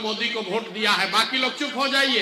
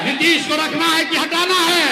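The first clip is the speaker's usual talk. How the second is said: higher, louder shouted question to the crowd; louder rally call; 5 semitones higher, 5 dB louder than usual. Shown as a demonstration. Speaker to rally crowd, shouting the question louder still. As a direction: push it out to the whole crowd. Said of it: "जोर से बोलो भैया".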